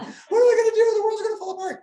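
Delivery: high, loud mock panic; hammed-up high-pitched wail; frustrated daughter. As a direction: squeal it like an overdramatic kid in crisis. Mimicking his frustrated daughter's panic.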